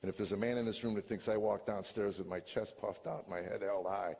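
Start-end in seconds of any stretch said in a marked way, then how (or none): none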